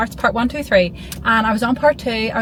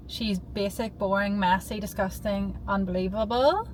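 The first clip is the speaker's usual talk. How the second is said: quiet; 8 dB quieter than usual. Real, not acted. Doting description of burger.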